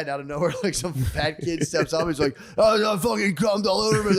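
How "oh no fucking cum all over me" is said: high-pitched, hammed-up wail; exaggerated high voice; mock stage amateur. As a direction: cry it out like a clueless open-mic comic. Acting out the kid stepping up.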